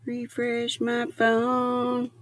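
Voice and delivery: slow, drawn-out Refresh my phone; half-talking to herself, slowed way down; slow musing. Real, not acted. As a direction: repeat it slowly to yourself.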